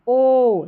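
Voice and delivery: ओ held very long; slow, sustained ओ; long vowel. Shown as a demonstration. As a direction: stretch ओ right out. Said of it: "लंबा उच्चारण".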